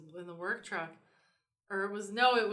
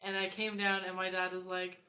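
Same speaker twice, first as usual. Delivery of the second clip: ordinary talk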